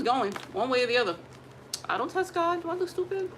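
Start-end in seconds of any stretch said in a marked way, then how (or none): 1.9-3.3 high, incredulous; sassy indignation. Acted. To viewers about testing God, high sassy disbelief. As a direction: raise it high in mock outrage.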